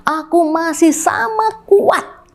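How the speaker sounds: ordinary talk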